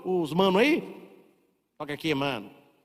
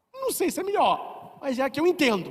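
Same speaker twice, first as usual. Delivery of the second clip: lightly voiced old man; 8 semitones higher than usual. Old man replies to professor.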